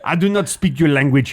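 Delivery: put-on stilted French-accented English. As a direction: play a French person struggling in English. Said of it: "a French person trying to speak English".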